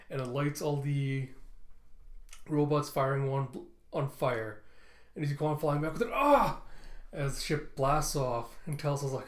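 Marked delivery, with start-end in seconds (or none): 6.1-6.6 high, loud yell of panic; short, high mock scream; acted scream. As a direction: scream as if thrown backwards. Quan flung back at blastoff.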